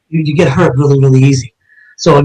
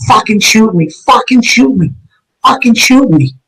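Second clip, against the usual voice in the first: retold scream; 7 semitones higher than usual. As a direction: imitate a desperate scream without the volume. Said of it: "screaming at the top of his lungs".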